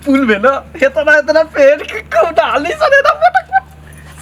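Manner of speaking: high, loosening into laughter; giggly excitement. Acted. Villager describes girls at the swimming pool, giddy laughter. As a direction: get carried away and break into a high giggle.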